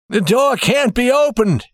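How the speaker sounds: high-pitched cry of alarm. A narrator's reading. Old banker at the jammed safe, crying out in alarm. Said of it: "he cried".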